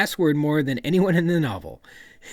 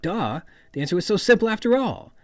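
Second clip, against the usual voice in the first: mock sarcastic duh; 6 semitones higher, 3 dB louder than usual. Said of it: "something like sarcasm".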